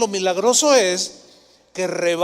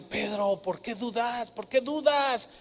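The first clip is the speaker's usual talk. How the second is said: softer, tender Jesus chiding Peter; gentle rebuke; 3 semitones higher, 6 dB quieter than usual. Retold or imitated. Jesus holding Peter's hand, softly asking why he doubts. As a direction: ask it gently, like a patient teacher. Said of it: "le dice".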